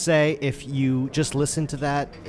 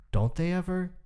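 put-on higher, childlike voice for the boy; young boy asking; 7 semitones higher than usual. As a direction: voice a small curious son. Young Nick questions his father.

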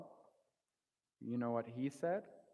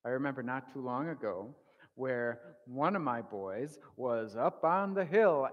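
usual speaking voice

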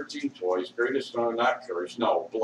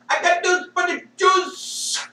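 much higher, put-on shouting voice; hammed-up yell in a put-on voice; mock shout; 4 dB louder than usual. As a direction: belt it out like a mocking rally cry. Repeated line, shouted in a put-on voice.

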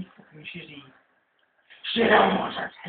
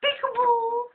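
peekaboo call; 6 dB louder, slower than usual. Pretend. Playful jump-scare call.

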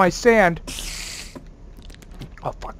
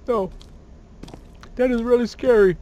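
ordinary talk

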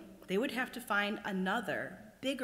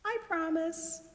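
put-on high, small young crab; 12 semitones higher than usual. A narrator's reading. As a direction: ask it in a tiny voice. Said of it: "said the little crab".

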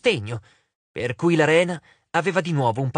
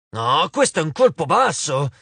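growled retort; 4 dB louder than usual. A narrator's reading. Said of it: "ringhiò Efialte".

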